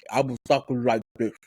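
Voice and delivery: mushy, muffled talk from a broken crown; mush mouth. Shown as a demonstration. Host showing how a broken crown made them talk, mush-mouthed. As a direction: demonstrate slurred, mush-mouthed speech. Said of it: "my mush mouth impression".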